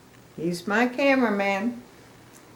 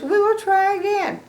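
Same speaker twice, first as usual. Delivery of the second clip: much higher echoing voice; eager Gerald echo; 13 semitones higher, 5 dB louder than usual. Acted. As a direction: echo her line eagerly. Gerald echoes Piggy.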